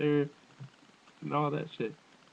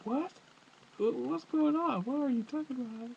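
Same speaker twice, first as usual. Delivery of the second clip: mock confusion; 10 semitones higher than usual. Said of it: "it's like".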